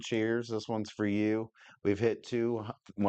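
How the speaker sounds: ordinary talk, nothing marked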